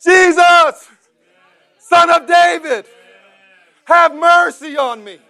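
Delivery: high, loud, slow desperate cry. Acted. Blind man cries to Jesus over the crowd, loud desperate shout. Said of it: "he cried out all the more".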